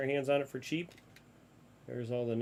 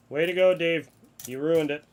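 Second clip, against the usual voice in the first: sarcastic; 4 semitones higher, 10 dB louder than usual. Real, not acted. Scolding Dave for a mistake.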